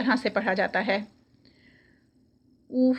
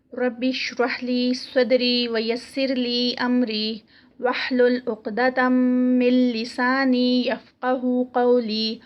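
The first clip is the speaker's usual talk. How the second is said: slow, measured Arabic recitation; دعا کی تلاوت; much slower than usual. Real, not acted. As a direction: recite the opening prayers slowly, drawing out the long vowels. Opening supplications before the lesson. (ٹھہر ٹھہر کر دعا کی تلاوت)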